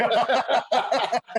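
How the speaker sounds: a loud, high burst of real laughter; hearty laugh. Real, not acted. Host laughs at wrench joke.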